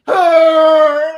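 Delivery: loud, high anguished scream; agonized scream. Retold or imitated. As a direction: scream like your gut explodes. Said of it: "you just hear".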